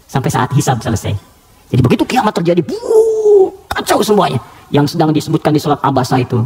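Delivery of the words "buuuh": much higher drawn-out whoosh for chaos; sudden dramatic whoosh of destruction; booming whoosh. Acted. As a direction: sound out the world collapsing. Depicting the apocalypse.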